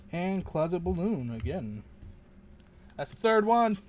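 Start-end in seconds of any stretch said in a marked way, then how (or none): 3.0-3.8 louder, higher cry of disbelief; exasperated exclamation. Real, not acted. Pulling yet another duplicate card, exasperated. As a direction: groan out a third duplicate.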